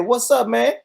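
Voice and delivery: high, loud, excited hello; loud greeting. Retold or imitated. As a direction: holler a friendly greeting. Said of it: "we loud when we say that".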